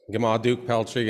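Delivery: ordinary delivery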